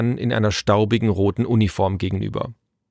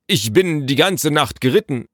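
higher, lightly aggrieved official voice; reporting messenger; 6 semitones higher, 4 dB louder than usual. A narrator's reading. Messenger telling Naru of his night's ride, stiffly. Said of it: "berichtete der Bote".

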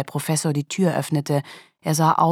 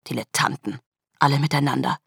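angry hiss. Acted. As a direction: hiss the insult at the whole group. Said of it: "zischte Neff erbost". (leise, tief und erbost gezischt)

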